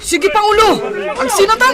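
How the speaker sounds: loud, high answer to the chief; shouted reply. Acted. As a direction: shout back over the noise of battle. Warrior answers in battle. (nagpukkaw a sungbat)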